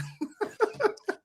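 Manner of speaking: giggling squeal; high giggle breaking out; high squealing laugh. Real, not acted. Laughs at own story.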